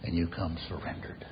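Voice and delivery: tender whispered invitation. Real, not acted. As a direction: invite them softly. Altar call, hushed.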